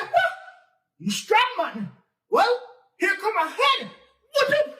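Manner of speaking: high, loud taunting voice; cocky challenge. Acted. Nancy challenging the tar baby, high cocky taunt. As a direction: dare him to take another hit.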